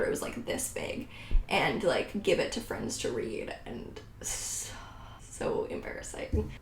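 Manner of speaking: whispered, cringing aside; whispered, mortified "so embarrassing"; embarrassed whisper. Real, not acted. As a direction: confess it under your breath. Cringing at her teen novel.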